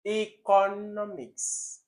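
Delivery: hammed-up wrong economics; exaggerated wrong pronunciation. Shown as a demonstration.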